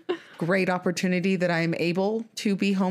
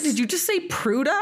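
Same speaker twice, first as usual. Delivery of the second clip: higher-pitched mock disbelief; imitating incredulous question; 8 semitones higher than usual. Retelling Derek questioning the pronunciation, mock incredulous. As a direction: query "Pruda" as if misheard. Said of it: "he was like".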